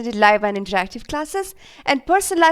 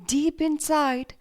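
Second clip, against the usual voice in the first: guided meditation; 4 dB quieter than usual. Acted. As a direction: guide children gently into calm, phrase by phrase.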